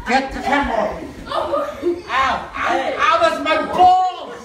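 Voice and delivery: loud panicked yelling, laughing; screaming at a spider. Real, not acted. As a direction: yell in panic and pain.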